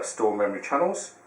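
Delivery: ordinary delivery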